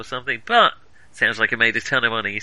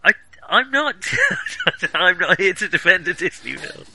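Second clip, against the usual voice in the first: laughing protest; 7 semitones higher, 4 dB louder than usual. Real, not acted. Laughing protest at Disney jab.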